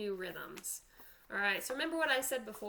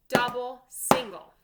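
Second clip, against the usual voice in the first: loud counted drum call; loud called sticking; 8 dB louder than usual. Shown as a demonstration. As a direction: call each stroke out loud, like a drum teacher. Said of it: "Let's do it slowly first".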